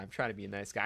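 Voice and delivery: quiet self-mocking repeat; muttered aside. Shown as a demonstration. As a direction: mutter it to yourself.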